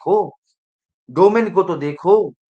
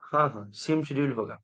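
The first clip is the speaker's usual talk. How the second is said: normal talking voice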